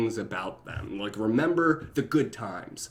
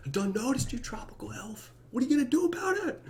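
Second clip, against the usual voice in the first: much higher and fast, teasing challenge; playful swagger; 13 semitones higher, 4 dB quieter, faster than usual. Real, not acted. Vlogger teasing a commenter who begged for notice, cheeky. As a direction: taunt the fan cheekily. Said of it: "playful reply to commenter".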